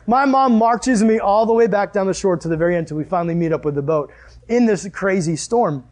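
usual speaking voice

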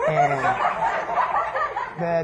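can't stop laughing mid-story. Real, not acted. Preacher cracking up at his own story, laughing. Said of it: "laughing while preaching".

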